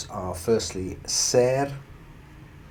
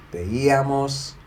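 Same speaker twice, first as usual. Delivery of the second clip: veíamos with a drawn-out, higher, louder Í; stressed I sound; 6 semitones higher, 5 dB louder, slower than usual. Shown as a demonstration. Teacher conjugating ver for learners, stressed I sound. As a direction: stretch and lift the Í in veíamos. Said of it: "you have to stress the I sound".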